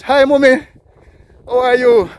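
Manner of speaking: high, loud friendly call. Real, not acted. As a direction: call out a greeting across the street. Greeting lady across street.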